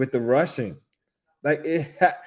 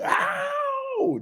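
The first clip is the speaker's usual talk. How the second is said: very high falsetto "Ow!" yelp. Retold or imitated. Imitating [name]'s yelp at the movie.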